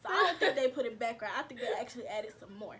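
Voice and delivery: laughing through a joke about herself. Real, not acted. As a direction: giggle through the punchline. Joking about weight, laughing.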